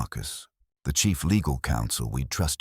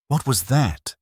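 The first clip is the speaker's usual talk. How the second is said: higher, louder sharp question; sharper; 8 semitones higher, 4 dB louder than usual. A narrator's reading. Wife demanding answer about the torn letter, sharp. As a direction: snap it out, caught off guard. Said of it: "her voice sharper".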